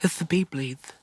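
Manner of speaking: put-on high little kid lisp; lisping small boy voice; child with a lisp. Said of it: "little lisp".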